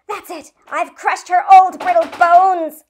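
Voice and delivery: horrified gasp. A narrator's reading. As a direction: gasp it in horror. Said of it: "Leno gasped".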